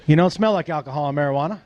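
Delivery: low, fast official verdict; police officer's voice. Retold or imitated. Cop to driver after sniffing, low official voice. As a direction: drop the pitch like an officer assessing you.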